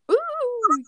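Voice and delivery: high, drawn-out ooh; impressed ooh. Retold or imitated. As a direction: coo like an impressed kid. Everyone reacting in awe.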